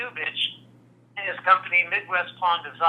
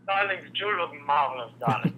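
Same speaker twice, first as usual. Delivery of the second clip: Fernando; 2 semitones lower, 4 dB quieter than usual. Acted. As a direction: croon it like a vain showman. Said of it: "now do Fernando".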